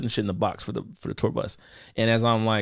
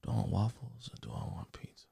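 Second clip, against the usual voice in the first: soft, unvoiced whispered inner debate; muttering to himself; 4 semitones lower, 16 dB quieter than usual. Tired Domino's worker debating waffles or pizza, whispering. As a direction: whisper it to yourself, undecided. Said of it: "I'm like".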